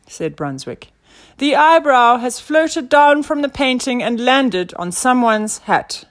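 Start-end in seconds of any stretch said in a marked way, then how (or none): 1.4-6.1 loud, high declaration; pompous detective explaining his theory; self-assured inspector. A narrator's reading. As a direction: proclaim the deduction.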